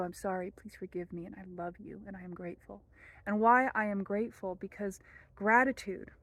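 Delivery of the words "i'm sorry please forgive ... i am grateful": soft, hushed prayer recitation; quiet prayer. Shown as a demonstration. Teacher reciting the prayer for viewers, hushed and soft. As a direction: murmur it like a private prayer.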